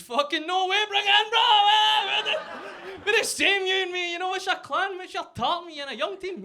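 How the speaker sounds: very high, loud, gushing enthusiasm; hammed-up matey excitement, as if placating someone; mock over-keen agreement. Comic to armed Texan, overdone high excited bonding. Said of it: "so what I actually said was".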